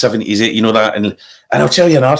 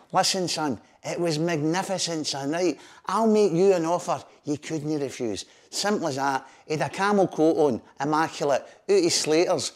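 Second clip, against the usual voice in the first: hushed gangster talk; 10 dB quieter than usual. Retold or imitated. Bertie voiced, mock mafia talk.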